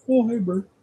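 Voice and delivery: a quick bright muppet hello; Ernie impression. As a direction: repeats the greeting in the high muppet voice. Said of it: "Sound kinda like that".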